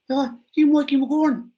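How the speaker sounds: loud, high-pitched mock Dublin accent. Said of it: "That's my Dublin accent".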